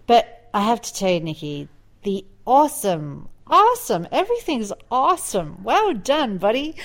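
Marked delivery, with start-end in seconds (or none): 2.0-5.6 gushing, high-pitched cheer, hammed up; mock perky enthusiasm. Mocking perky American enthusiasm. 5.6-6.7 chirpy, higher pat on the back; hammed-up peppy praise; mock cheer. Mocking perky American cheer.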